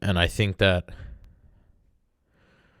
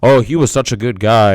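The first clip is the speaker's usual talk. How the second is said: a lightly voiced, higher, louder sentimental eulogy; mock mourner; 3 semitones higher, 7 dB louder than usual. Mimicking mourners who praise the dead too late. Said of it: "that people go like".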